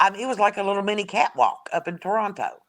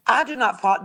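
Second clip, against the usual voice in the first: ordinary talk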